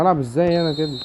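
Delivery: usual speaking voice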